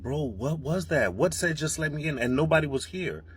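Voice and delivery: high-pitched, spooked disbelief; freaked out. Real, not acted. Spooked man telling viewers nobody was in the house, freaked out. Spooked after playing mystery sound.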